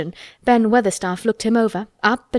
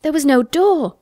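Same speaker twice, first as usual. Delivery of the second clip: higher-pitched astonished protest; crying out; 7 semitones higher, 4 dB louder than usual. A narrator's reading. Colin puzzled how the gardener entered, crying out. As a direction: insist in disbelief, pitch jumping up. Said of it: "cried Colin".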